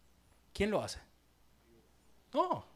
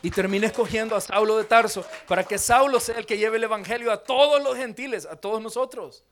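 loud, high, climactic proclamation; preaching shout; 5 semitones higher, 12 dB louder than usual. Real, not acted. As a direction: belt it out to the congregation.

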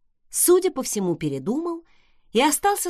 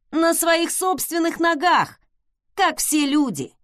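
higher, loud and sure; confident shout; 7 semitones higher, 5 dB louder than usual. A narrator's reading. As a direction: cheer him on boldly. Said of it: "с уверенностью ответил он".

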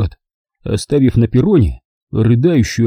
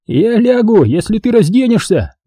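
defiant; 10 semitones higher than usual. A narrator's reading. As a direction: taunt the cop defiantly. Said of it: "вызывающе ответил он".

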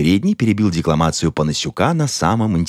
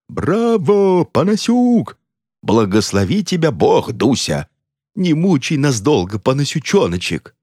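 восторженные возгласы; 7 semitones higher than usual. Acted. Толпа приветствует рассказчика.

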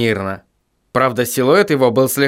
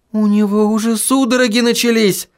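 alarmed exclamation; 9 semitones higher than usual. Acted. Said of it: "воскликнула Сахариса".

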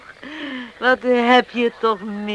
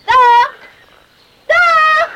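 loud, higher goodbye across a distance; calling out; 9 dB louder than usual. Acted. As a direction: call it back over your shoulder. Calling goodbye while walking away. (luid roepend afscheid)

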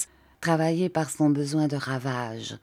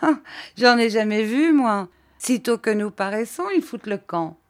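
higher, mocking, amused; mocking laugh; 6 semitones higher than usual. A narrator's reading. Drunk marquis mocks the French men, laughing. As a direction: scoff at her, merry with wine. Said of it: "se mit à rire".

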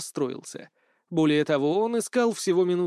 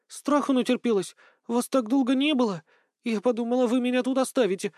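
high, aggrieved complaint; indignant; 7 semitones higher than usual. A narrator's reading. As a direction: complain as the hard-done-by victim. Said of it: "возмущалась Мила".

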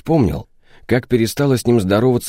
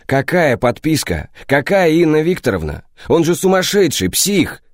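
rising to a yell, higher and higher; breaking into a shout; 6 semitones higher than usual. Acted. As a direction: shout it, losing control. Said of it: "сорвался на крик главный редактор".

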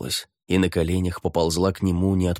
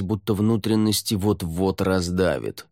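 ordinary delivery

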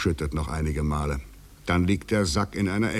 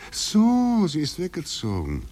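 mocking contempt; 8 semitones higher than usual. Taunting Anna's new life.

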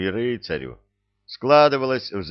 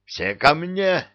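barked command; 7 semitones higher, slower than usual. A narrator's reading. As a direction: bark it out to the group. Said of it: "рявкнул юноша". (рявкнуть команду)

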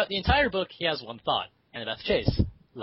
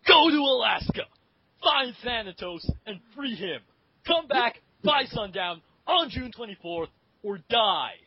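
dramatic prophecy; 4 semitones higher, slower than usual. Pretend.

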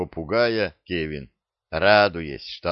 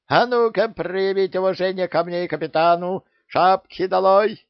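screeching order; 12 semitones higher, 6 dB louder than usual. Acted. Parrot commands the crew.

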